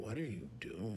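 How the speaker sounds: hushed, very soft question; retold whisper. Kid under the bed questions the girl, hushed whisper. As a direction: ask it under your breath, as if whispering. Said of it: "I was whispering".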